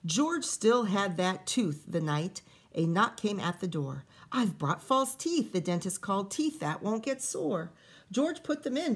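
lively read-aloud storytelling voice; story reading. A narrator's reading. Teacher reading a picture book to kids, lively storytelling. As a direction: read the rhyming story to the children.

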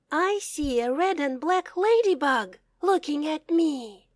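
ordinary delivery

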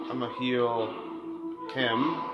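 ordinary delivery

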